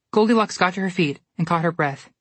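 usual speaking voice

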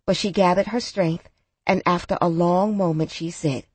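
ordinary delivery, nothing marked

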